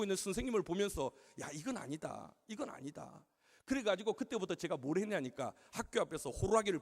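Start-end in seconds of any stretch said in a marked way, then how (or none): 1.4-3.2 low, soft aside of disapproval; muttered to himself. Retold or imitated. Pastor watching the teacher scold, muttering to himself. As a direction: drop the voice and disapprove privately.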